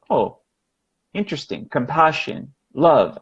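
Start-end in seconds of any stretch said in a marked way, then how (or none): 0.1-1.7 calm curiosity. Shown as a demonstration. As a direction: let it go quiet and curious.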